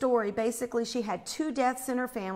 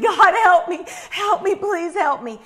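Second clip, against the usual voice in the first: high, loud acted cry for help; imitated frantic plea; 7 semitones higher, 8 dB louder than usual. Imitating the panicked woman answering her rescuer, frantic pleading. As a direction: beg desperately, raised high and loud. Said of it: "screaming and yelling and running".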